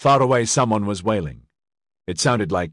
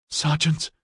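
desperate; 7 semitones higher than usual. A narrator's reading. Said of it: "Ediard sent in desperation".

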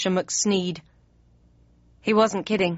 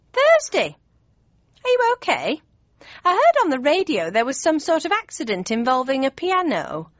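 worried mother; 7 semitones higher than usual. Acted. Mum worries about Thursday after the accident, high anxious voice. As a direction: fuss over her daughter, pitched up high.

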